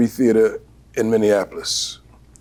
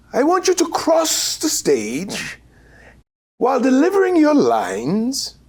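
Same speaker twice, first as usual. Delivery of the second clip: British director impression. Actor imitating his British director giving stage notes, high and grand. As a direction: lift way up into the grand old theatre director. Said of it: "very British, very tall, very gaunt, very British, says".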